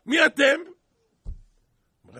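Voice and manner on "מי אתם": high, booming challenge; imperious shout. Acted. Pharaoh confronts Moses and Aaron, imperious loud shout. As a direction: thunder the question as an acted Pharaoh.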